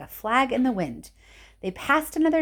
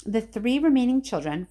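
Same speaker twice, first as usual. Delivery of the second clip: ordinary delivery, nothing marked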